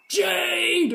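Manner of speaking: high calling shout of the dog's name; calling out Jade. Retold or imitated. Toddler yelling the dog's name when something breaks. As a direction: yell the name like a toddler blaming the dog. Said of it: "she's like".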